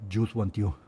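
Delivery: a hint of a Spaniard speaking English; Spanish accent in English. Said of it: "puedo imaginar a un español diciendo".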